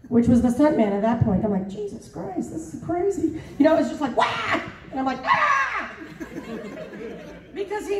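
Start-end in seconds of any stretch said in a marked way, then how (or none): 1.7-3.4 high, hushed mock panic; alarmed disbelief. Retells shock at stunt man jumping. 4.2-4.6 high mock yelp of fright; short comic cry, retelling the scare; panicked yelp. Imitates panic during the stunts. 5.3-5.9 high, sustained comic scream; mock scream. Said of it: "you hear my voice screaming".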